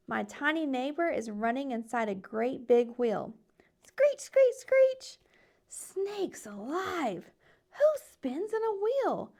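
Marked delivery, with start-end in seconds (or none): 3.9-5.2 very high, sharp squeaky screeches; hamster screech. A narrator's reading. Hamster in cage next door, high squeaky screeching. Hamster wheel noise imitated. 5.7-9.3 slow, drawn-out astonished exclaim; surprised. A narrator's reading. Frog amazed at hamster on wheel, slow surprised exclaim.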